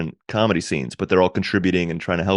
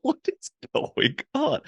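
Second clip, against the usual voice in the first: high, slow disbelieving squeak; bewildered squeak; 5 dB quieter, slower than usual. Retold or imitated. Bewildered on a messy comedy tour.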